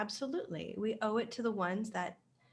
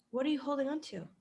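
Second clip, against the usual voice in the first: ordinary talk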